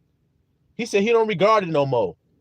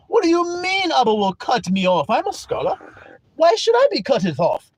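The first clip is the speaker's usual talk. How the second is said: smug scholar; 2 semitones higher than usual. Acted. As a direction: play a pompous scholar sure his ascot saves him.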